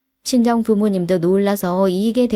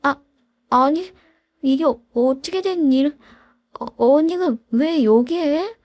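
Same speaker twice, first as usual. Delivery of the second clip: higher, stammering in panic; stammering shock; 5 semitones higher than usual. Acted. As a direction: caught out and terrified at the interview. 사색이 되던데요. (당황해서 말을 더듬는 목소리)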